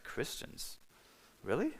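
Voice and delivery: very soft, almost whispered disbelief; retold incredulous question. Doubting his friend's word about Christians, hushed incredulity. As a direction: question it under your breath, doubtful.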